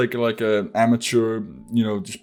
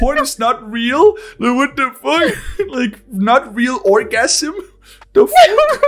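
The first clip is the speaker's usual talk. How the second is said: squeaky high, slow incredulous; shocked disbelief; 14 semitones higher, 5 dB louder, slower than usual. Retold or imitated. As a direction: stretch it out, laughing in shock. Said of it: "it was like".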